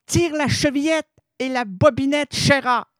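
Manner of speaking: cue the audience to say it together; leading the audience. Acted. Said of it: "On le dit ensemble".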